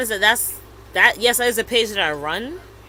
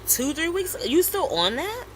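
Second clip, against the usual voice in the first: incredulous; 8 semitones higher than usual. Retold or imitated. Incredulous at an old grievance.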